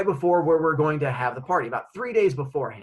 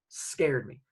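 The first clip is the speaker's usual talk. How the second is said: ordinary talk, nothing marked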